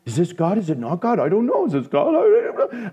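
panicky, wavering second-guessing, pitch jumping up; mock frantic doubt. Preacher acting out a believer doubting God's voice, mock frantic. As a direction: act out dithering in exaggerated worry. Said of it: "that kind of terror or, uh, doubt".

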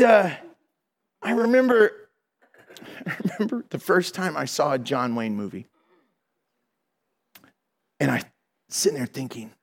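ordinary talk, nothing marked

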